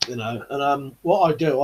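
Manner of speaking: usual speaking voice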